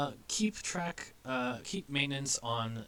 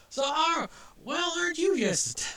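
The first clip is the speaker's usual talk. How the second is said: reach for a folksy rural Southern voice and fumble; Southern accent; 4 dB louder than usual. Acted. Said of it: "I have this accent in my brain, and I can't do it".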